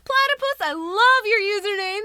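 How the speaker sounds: high, loud delighted gush; excited raid greeting. Real, not acted. Greeting raiders who just arrived.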